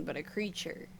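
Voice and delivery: ordinary talk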